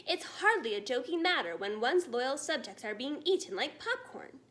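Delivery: ordinary delivery, nothing marked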